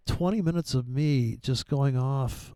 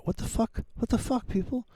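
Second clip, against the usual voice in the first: high, breathy what the fuck; exasperated disbelief; 9 semitones higher, 3 dB quieter than usual. Real, not acted. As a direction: throw up your hands in disbelief. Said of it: "exasperated outburst".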